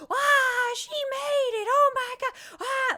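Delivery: very high, loud squealing welcome; imitated ecstatic group shriek; erupting family cheer. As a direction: scream like a whole table greeting you. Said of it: "all eight of them erupted with".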